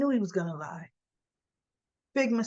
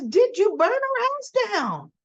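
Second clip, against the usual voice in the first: accusing; 10 semitones higher, 4 dB louder than usual. Pretend. Voicing the next accusing question.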